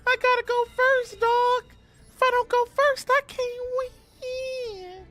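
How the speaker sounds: very high, loud mocking whine; mock the scrubs in falsetto; whiny scrub voice. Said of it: "All these other scrubs".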